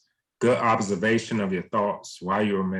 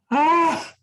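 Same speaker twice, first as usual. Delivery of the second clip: high, loud cry of dismay; frustrated "ah"; 7 dB louder than usual. Real, not acted. Host realizing the recording was missed, high frustrated "ah". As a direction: let out a high-pitched "ah" at your own slip.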